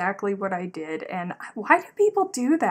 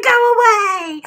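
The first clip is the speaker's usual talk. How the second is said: high, loud drawn-out dismissal; mock exasperated yell; 13 semitones higher, 13 dB louder, slower than usual. Cousin dismissing her clueless husband, mock exasperated yell. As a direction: shoo him off at full volume.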